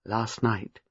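put-on soft, low elderly lady; gentle prompting. A narrator's reading. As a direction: nudge her quietly to continue. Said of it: "prompted Miss Marple".

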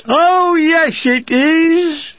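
mocking pantomime call-and-response; chorus shouted back. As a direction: bellow it back like a jeering crowd. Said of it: "a great chorus back".

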